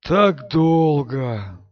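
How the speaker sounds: slow, sinister ancient voice; Aginor. A narrator's reading. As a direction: speak as an ancient evil sorcerer. Aginor's longing repeat.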